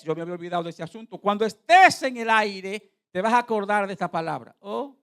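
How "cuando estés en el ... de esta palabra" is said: prophet's pronouncement. Retold or imitated. Preacher imitates the man warning him about the flight, prophetic pronouncement. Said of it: "y me dijo él".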